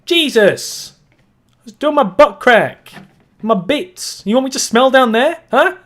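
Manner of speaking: high, loud indignant yelling; mock angry shout. Voicing Patrick shouting back at his wife, indignant. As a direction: yell back defensively as Patrick.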